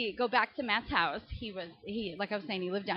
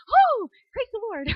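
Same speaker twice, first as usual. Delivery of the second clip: relieved praise; 12 semitones higher, 11 dB louder than usual. Retold or imitated. Relieved mother reacting.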